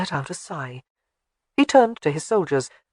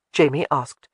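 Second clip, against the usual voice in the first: plain reading voice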